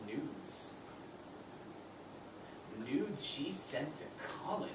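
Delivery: barely voiced, reeling in shock; hushed disbelief. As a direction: drop to a low, stunned murmur. Disgusted discovery of the photos.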